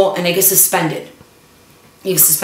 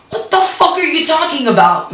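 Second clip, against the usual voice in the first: high incredulous inner voice; what the fuck said high in alarm; inner disbelief; 9 semitones higher than usual. Retold or imitated. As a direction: silent shocked disbelief. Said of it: "I'm not saying this. This is all in my head".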